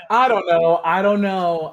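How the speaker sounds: loud, sighing "I don't know"; exasperated sigh. Real, not acted.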